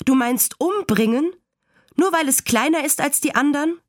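put-on high-pitched, indignant child's questions; entsetzt. A narrator's reading. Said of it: "schrie Fern entsetzt". (kindlich empörtes Nachfragen)

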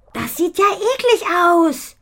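put-on high, loud and slow disgusted girl; blurted disgust. A narrator's reading. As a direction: let the 'eklig' burst out of you. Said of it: "entfährt es Ponifee". (angeekelt herausgeplatzt, hohe Stimme)

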